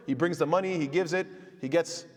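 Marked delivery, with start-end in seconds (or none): none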